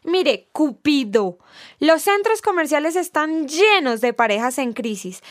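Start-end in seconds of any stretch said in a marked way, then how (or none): none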